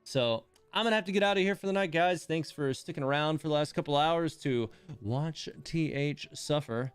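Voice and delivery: ordinary talk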